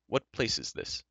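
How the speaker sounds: mildly indignant demand; angry question. A narrator's reading. Newcomer questions the dwarf about the place, angrily. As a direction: ask it with a touch of anger. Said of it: "questioned angrily".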